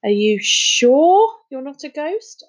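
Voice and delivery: almost disappointed. A narrator's reading. Little boy asking if he's really no ghost, almost disappointed. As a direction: drag it out with a touch of disappointment. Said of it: "sounding almost disappointed".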